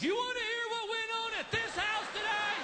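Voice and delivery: high-pitched, hammed-up furious scream; screaming wife. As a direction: shriek it at your husband, drawing out the end. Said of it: "Your wife screams".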